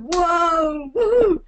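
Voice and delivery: loud, high whoop; whooping American. Shown as a demonstration. Showing becoming an American, loud high whoop. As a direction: whoop like a cheering fan. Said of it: "when you become an American".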